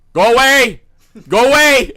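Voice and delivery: full-volume, high-pitched yell. Retold or imitated. Wife yelling at Kyle as he gets up at night, yelling. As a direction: yell it loud and high, imitating a startled wife. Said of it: "Heidi just starts yelling".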